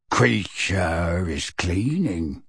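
slow, low croaking elf voice; creaky house-elf. Acted. House-elf croaking to Sirius, slow and low. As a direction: bent old servant elf, croaking slowly.

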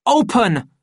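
high, loud one-word command; angry order. A narrator's reading. Savage kicking the bathroom door, loud command. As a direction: shout it as an order through a door. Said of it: "he ordered, kicking the door".

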